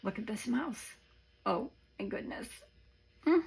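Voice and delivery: ordinary talk, nothing marked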